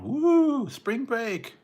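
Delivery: very high, loud woo then spring break; mock party whoop. Mimicking partiers on the beach, loud mock whoop. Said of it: "they're out there going".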